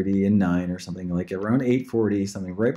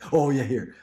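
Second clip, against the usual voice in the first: ordinary talk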